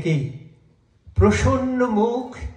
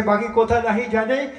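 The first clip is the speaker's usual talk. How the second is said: ordinary delivery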